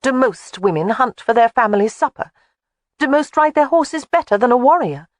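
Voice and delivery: high, rising indignant challenge; young woman getting riled; heated Jamie. A narrator's reading.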